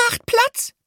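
tiny, very high squeak of „Macht Platz“. A narrator's reading. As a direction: squeak it like the smallest mouse. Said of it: "piepste Minzi".

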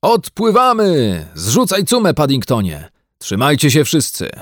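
excited captain calling out commands; shouted orders. A narrator's reading. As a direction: hail the whole crew. Captain shouting as they cast off.

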